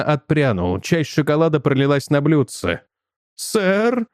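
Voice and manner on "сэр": high, nervous squeak of a question; frightened waiter, pitched high; breaking voice. A narrator's reading. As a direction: let it crack upward timidly. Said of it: "спросил он срывающимся голосом".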